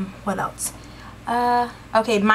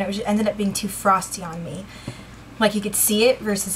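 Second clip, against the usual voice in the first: ordinary talk, nothing marked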